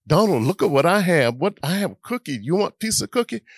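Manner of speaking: put-on a boy showing off his cookie; mock bragging brother; teasing kid.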